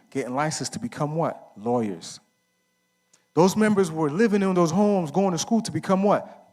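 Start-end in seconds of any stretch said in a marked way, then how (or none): 3.3-6.5 rising fervent call; preaching call-out. Real, not acted. Preaching-style call and response.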